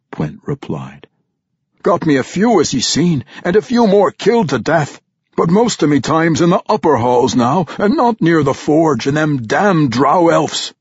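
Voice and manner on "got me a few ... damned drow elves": loud, high dwarf brogue, boasting; vampire dwarf bragging to his king; boastful vampire dwarf. Acted. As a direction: crow about the kills like a wild dwarf. Pwent boasts of killing drow.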